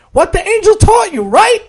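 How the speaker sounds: high, bright questioning voice; a cheerful cashier recognizing him; young woman voice. Acted. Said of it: "she said".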